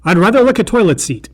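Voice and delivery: put-on high, loud kid-movie quip; Kevin quip. Narrator quoting the doll's bratty catchphrase, high and loud. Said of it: "classic Kevin McAllister quips like".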